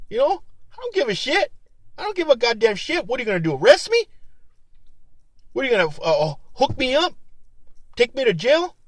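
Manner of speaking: high-pitched, scoffing dare; worked-up, mocking bravado; defiant taunt. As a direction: dare the cops to arrest you. Daring imagined police to arrest him.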